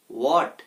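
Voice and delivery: "হোয়াট" said as "wot", no h; silent h. Shown as a demonstration. Teacher giving learners the correct form, "হোয়াট" with silent h. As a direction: open "হোয়াট" straight on a w. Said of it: "তাহলে এটার উচ্চারণ কি হবে".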